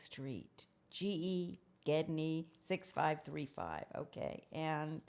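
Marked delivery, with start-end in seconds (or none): none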